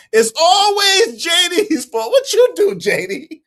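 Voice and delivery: high-pitched, exaggerated accusation; mock blame. Streamer playfully blames a regular viewer, high-pitched mock exasperation. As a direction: wail the blame as a joke.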